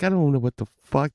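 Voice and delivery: usual speaking voice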